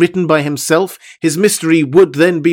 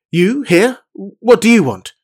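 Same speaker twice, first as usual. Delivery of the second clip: brusque and higher, a sharp challenge; rough; 7 semitones higher, 4 dB louder than usual. A narrator's reading. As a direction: snap at the intruder at his desk. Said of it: "frowned, and said roughly".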